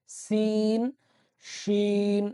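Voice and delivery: সিন front s then শিন inner sh, held; sin and shin contrast. Shown as a demonstration. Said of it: "বাহির থেকে ... ভেতর থেকে".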